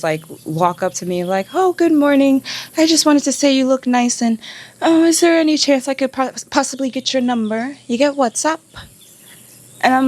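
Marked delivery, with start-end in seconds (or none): 1.5-8.6 higher, coaxing voice; lightly voiced eager officer; mock flirting officer. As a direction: imitate a smooth policeman asking for a number. Voicing an officer asking number.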